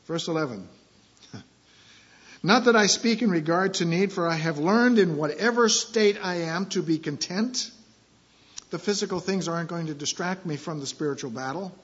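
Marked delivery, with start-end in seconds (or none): 2.4-7.7 louder, raised pitch reading of scripture. Acted. Pastor reading verse to congregation, proclaiming and raised. Reads scripture aloud in sermon.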